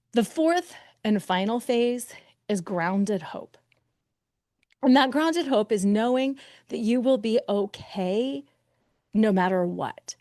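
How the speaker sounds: ordinary talk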